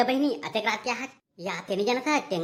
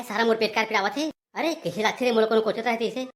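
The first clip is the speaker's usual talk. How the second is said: usual speaking voice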